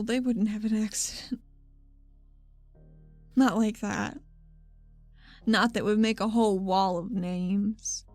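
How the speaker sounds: normal talking voice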